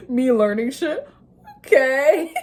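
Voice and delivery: laughing, high and loud; amused delight. Real, not acted. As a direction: giggle through it, pleased with yourself. Laughing at own progress.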